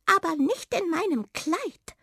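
high-pitched little mouse; Waldmaus, objecting. A narrator's reading. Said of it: "sagte die Waldmaus". (hohe Mäusestimme, abweisend)

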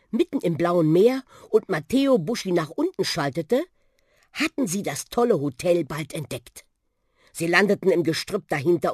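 ordinary delivery, nothing marked

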